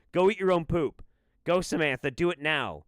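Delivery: loud, rising mock command; mock shouting order. Doctor yelling at a viewer to eat it. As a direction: bark it at her like a mad doctor.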